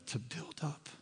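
whispered aside. Real, not acted. Sermon aside drops to whisper.

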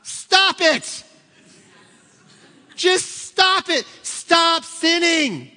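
high, loud, slow shout of stop; Jesus yelling at a believer to quit sinning, shouting. Pretend. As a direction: bellow it like a fed-up parent. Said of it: "Jesus would verbally say".

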